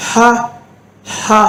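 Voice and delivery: ஹ pushed out with only air; ஹ as a short airy ha. Shown as a demonstration. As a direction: breathe out the h of ஹ. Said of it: "காத்து மட்டும்தான் வெளியே வரணும்".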